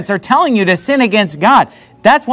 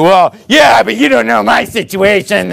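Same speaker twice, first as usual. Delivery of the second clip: mock objection. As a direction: shout it like a defensive churchgoer. Mocking a listener's objection.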